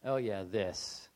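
slow, low resigned drawl; weary resignation. Retold or imitated. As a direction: drag it out, unimpressed. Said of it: "it's like".

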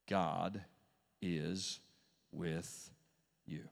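low, soft, one word at a time; solemn reminder. Real, not acted. Preacher reminds the congregation of God's presence, solemn and slow. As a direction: set each word down quietly, with a pause after it.

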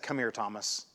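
soft, gentle call to a friend; softly coaxing Thomas closer; Jesus calling doubting Thomas to him, tender and soft; tender and hushed. Retold or imitated. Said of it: "Because Jesus loved him enough".

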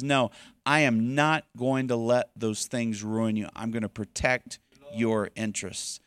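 soothe, don't preach; gentle voice of God comforting; reassuring God voice. Acted. God answering fearful thoughts.

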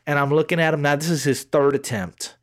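normal talking voice

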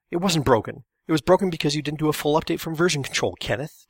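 loud, heated comeback; fired-back retort. A narrator's reading. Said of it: "Todd fired back".

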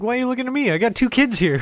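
high-pitched mock protest; mock indignation. Mocks the woman's quote.

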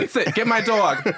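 bossy Jersey diva barking an order; Jersey accent. Pretend. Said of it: "we give her a Jersey voice".

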